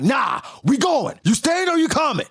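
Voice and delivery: high, loud hammed-up shout of a pirate captain; Rox barking orders. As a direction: bellow it like a pushy captain. Rox overruling Struson.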